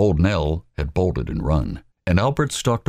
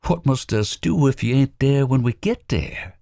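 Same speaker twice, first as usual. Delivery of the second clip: higher, worried question; anxious; 10 semitones higher than usual. Acted. Robert Lee asking Wallace, anxious. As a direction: fret over the plan. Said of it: "asked anxiously".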